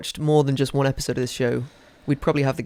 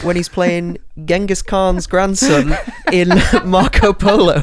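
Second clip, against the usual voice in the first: laughing through the words, louder and higher; slow, loud delivery breaking into laughter; amused; 3 semitones higher, 7 dB louder, slower than usual. Real, not acted. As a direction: crack up while delivering it. Amused at absurd casting.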